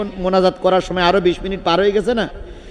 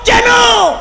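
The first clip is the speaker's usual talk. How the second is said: very high, drawn-out shout; shouted challenge; 7 dB louder, much slower than usual. Acted. Preacher playing Omar confronting his opponent, high sustained shout. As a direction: bellow it on one high held note. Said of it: "এবার হযরত ওমর বললেন".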